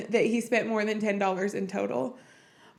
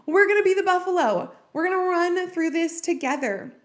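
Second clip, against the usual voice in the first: getting emotional; 6 semitones higher, 4 dB louder than usual. Real, not acted. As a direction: voice lifting higher and louder, fighting tears. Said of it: "Oh, sorry, I'm getting emotional".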